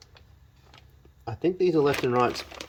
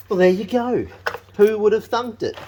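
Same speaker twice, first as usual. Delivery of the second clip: surprised; 8 semitones higher, 6 dB louder than usual. Real, not acted. Surprised it fits.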